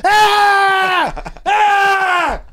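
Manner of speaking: high, loud drawn-out yell; imitated pain scream. Castmate kicked in the calf, mock pain scream. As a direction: scream like the kicked player, hammed up. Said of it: "And he goes".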